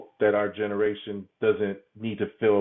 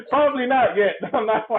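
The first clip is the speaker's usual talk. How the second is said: high, louder laughing reply; laughing it off, much higher and louder; 13 semitones higher, 6 dB louder than usual. Real, not acted. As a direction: giggle the answer away. Laughs off the question.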